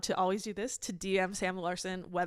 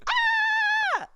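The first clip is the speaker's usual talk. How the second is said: sudden very high shriek; high squeal; 6 dB louder than usual. Real, not acted. Host squealing at her own joke, very high. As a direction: squeal with glee.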